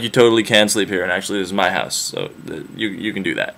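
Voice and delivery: calmly overrule, trailing off; fading quiet assertion. Retold or imitated. Penelope overruling the suitor, low trailing-off calm.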